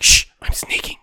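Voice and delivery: playful unvoiced stage whisper; acted whisper. Boyfriend sneaking behind girlfriend at night, playful whisper. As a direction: shush her as if sneaking past a sleeper. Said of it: "be quiet because someone's sleeping".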